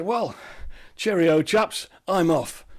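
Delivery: loud, bright, breezy goodbye; cheery farewell. Acted. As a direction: toss it off cheerfully as you bolt. Mo cheerfully escapes.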